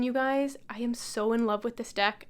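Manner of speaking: normal talking voice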